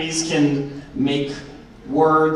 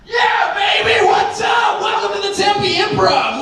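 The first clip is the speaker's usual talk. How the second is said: hype shout. Acted. Comic pumping up the crowd, high hype shout. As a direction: blast the room with energy. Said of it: "get the energy up in the room".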